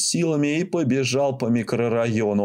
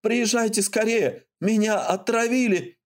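high, frantic pleading; desperate plea; 10 semitones higher than usual. Acted. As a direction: beg for help in panic. Drunk man begging ambulance.

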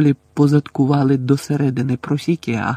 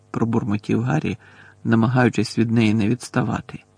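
usual speaking voice

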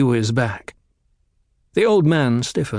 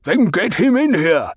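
Baron voice; 7 semitones higher, 3 dB louder than usual. A narrator's reading. Baron commands.

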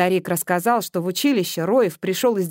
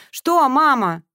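higher, louder annoyed snap; irritated; 6 semitones higher, 7 dB louder, slower than usual. Acted. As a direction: let the irritation slip out. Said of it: "Уловив в своём голосе раздражение".

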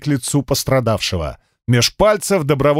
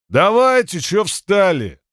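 rowdy shout; 5 semitones higher than usual. Acted. Crowd member eggs on fighters.

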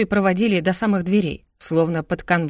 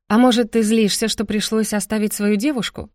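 plain reading voice

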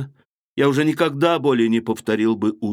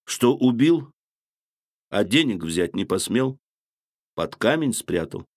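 caustic smirk; 3 semitones lower than usual. Acted. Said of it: "прибавил он с едкой усмешкою".

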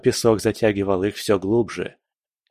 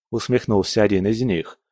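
plain reading voice

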